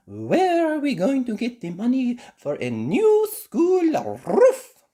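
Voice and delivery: high, loud fretting question; anxious teacher. Acted. Madame Gazelle fretting to Mister Bull, high rising. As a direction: worry about the money.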